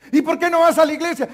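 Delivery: loud insistent question. Acted. Pastor hammering an ex-member with the same question, loud. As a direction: keep pressing him for a reason.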